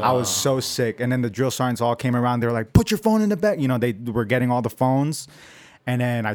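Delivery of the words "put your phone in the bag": high, urgent mock command; retold sergeant's order. Drill sergeant collecting recruits' phones, imitated urgent order.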